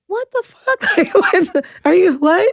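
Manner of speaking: high, shocked "what the fuck" with a laugh; reliving the moment the news hit; stunned disbelief. Retold or imitated. Hearing tumor news from doctor.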